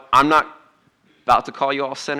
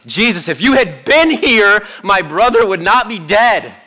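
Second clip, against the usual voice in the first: higher, louder grieving reproach to Jesus; anguished plea; 7 semitones higher, 4 dB louder than usual. Acted. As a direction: cry out the loss like a sister let down. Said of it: "Kinda hear that angst in there again?".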